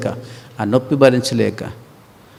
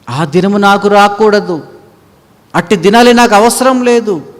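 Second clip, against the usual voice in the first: higher, louder declared vow; earnest resolve; 8 semitones higher, 5 dB louder than usual. Pretend. Timothy resolving to follow God, earnest vow. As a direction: vow it firmly.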